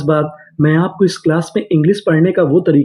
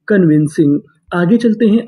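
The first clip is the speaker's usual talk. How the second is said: ordinary talk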